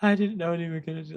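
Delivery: high, whiny put-on girl voice; mock girlfriend. A boyfriend mimics his girlfriend's excuse, high whine. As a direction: parody her excuse in a squeaky high voice. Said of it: "she's like".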